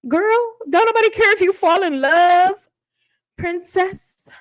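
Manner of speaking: high, sassy lecture aimed at the contestant; sassy scolding. Acted. Vlogger scolding the reality contestant in a high sassy voice. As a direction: talk down to her, girlfriend style.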